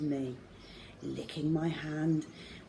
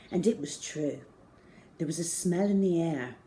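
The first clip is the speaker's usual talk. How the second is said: ordinary delivery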